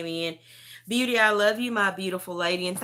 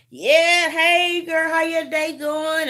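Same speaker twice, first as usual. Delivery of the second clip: put-on high, drawn-out girlfriend greeting; imitated video-call chat, pitched way up; chatty greeting; 8 semitones higher, 6 dB louder, slower than usual. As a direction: act out a bright, loud "hey girl".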